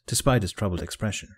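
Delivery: ordinary delivery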